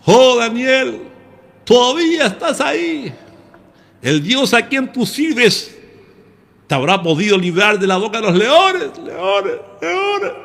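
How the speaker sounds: king calling into the lions' den, high and mournful; grieving king. Retold or imitated. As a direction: wail for Daniel like a grieving king. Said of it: "lo vamos a poner así como".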